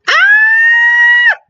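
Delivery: long piercing scream; grito liberador. Real, not acted. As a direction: scream at the top of the range. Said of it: "¡Ya puedo gritar!". (grito agudísimo y fuerte)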